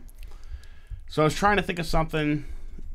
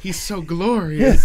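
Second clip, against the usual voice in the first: Will Ferrell quote; 5 semitones higher, 7 dB louder, slower than usual. Retold or imitated. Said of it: "Will Ferrell says".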